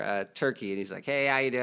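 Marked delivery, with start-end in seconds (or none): 1.0-1.6 imitating a guy's broad American accent; mock American video-host greeting; total American accent. Preacher mimics an airline video guy, total American accent. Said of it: "this guy with this total American accent".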